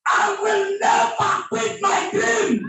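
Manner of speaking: high, ringing vow; rousing declaration. Shown as a demonstration. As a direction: lift the crowd with it. Preacher leads shouted declaration.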